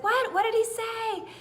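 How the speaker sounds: high, bewildered questions; puzzled crowd. A narrator's reading. Garden crowd baffled by the grasshopper, high-pitched.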